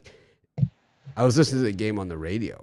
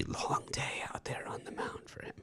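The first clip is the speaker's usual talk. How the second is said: ominous whisper; 13 dB quieter than usual. Acted. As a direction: whisper it like a warning. Ominous prediction about the pitcher.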